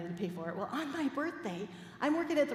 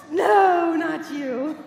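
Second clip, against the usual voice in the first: loud, high teasing denial; playful shout; 7 semitones higher, 14 dB louder, much slower than usual. Pretend. Minister teasing the congregation, playful shout. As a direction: shout it out in mock protest.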